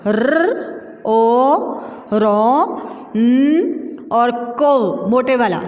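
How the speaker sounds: each letter of रौनक apart, heavy क; slow letter spelling. Shown as a demonstration. Teacher spelling रौनक for the class. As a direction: sound out रौनक letter by letter, slowly. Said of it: "रौनक".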